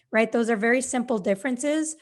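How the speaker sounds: ordinary delivery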